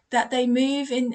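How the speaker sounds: ordinary delivery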